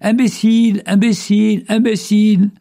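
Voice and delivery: high echo of an insult; voix en écho. A narrator's reading. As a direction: repeat it identically, like a valley echo. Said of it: "Et les voix répondirent". (écho aigu et répété)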